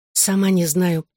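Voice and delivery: ordinary delivery, nothing marked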